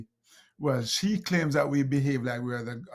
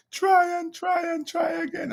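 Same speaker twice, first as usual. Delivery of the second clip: high, loud tearful shout of the motto; tearful daughter yelling; 6 dB louder than usual. Retold or imitated. Crying daughter in the driveway yells the motto back at her dad, high and loud. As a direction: shout it through tears like a frustrated seven-year-old. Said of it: "she's wiping tears away and said".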